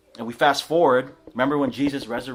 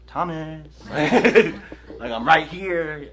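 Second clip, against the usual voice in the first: surprising Jesus; 4 semitones higher, 6 dB louder, slower than usual. Retold or imitated. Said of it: "It's like".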